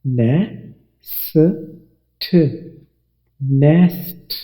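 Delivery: नै, स, ठ said one by one, then blended into नेस्ट; sound out nest. Shown as a demonstration. Teacher blending नेस्ट sound by sound. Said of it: "आप यहाँ इसकी आवाज़ को देखिए".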